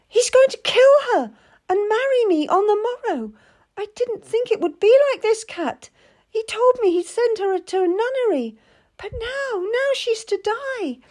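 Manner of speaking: put-on high, anxious girl pleading; a naive girl close to panic; frightened young lady. A narrator's reading. Terrified lady clings to Cat, high anxious pleading.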